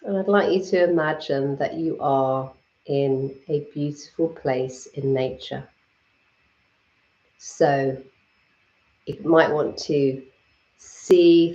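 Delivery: calm, unhurried meditation guide with long pauses; guided meditation. Acted. Guiding live viewers through a Reiki meditation, calm and unhurried. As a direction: lead the listeners gently into their breath and body.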